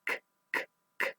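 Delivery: k k k as a bare unvoiced sound; repeated k sound. Shown as a demonstration. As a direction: isolate the k sound. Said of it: "That first sound".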